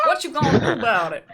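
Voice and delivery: hammed-up South African accent. Shown as a demonstration. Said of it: "Do the accent again".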